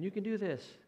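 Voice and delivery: encouraging parent. Retold or imitated. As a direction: cheer the kid on from the stands. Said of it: "Most parents will be saying".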